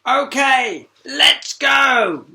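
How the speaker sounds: high, loud eager cry; rally everyone to go; eager launch call. Acted. Sheila announces launch.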